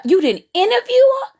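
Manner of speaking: high, quick, rising disbelief; incredulous question. Real, not acted. Viewer challenges the interviewer over the failed interview, incredulous. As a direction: shoot up in pitch, astonished.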